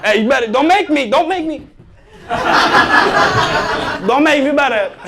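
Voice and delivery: high, laughing mock threat. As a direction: giggle while scolding.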